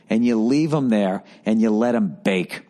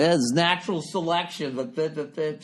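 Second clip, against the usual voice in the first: higher, airy put-on parent voice; mocking lazy parent; 6 semitones higher, 4 dB quieter than usual. Host mocking park parents who excuse bullying, in a smug higher voice. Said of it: "they start doing, like".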